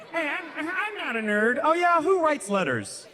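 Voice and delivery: high, whiny pretend kid voice; mock kid argument. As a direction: play the bratty kid arguing back.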